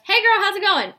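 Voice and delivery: high, loud peppy greeting; hammed-up generic sales opener; mock "hey girl". Shown as a demonstration. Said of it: "not just".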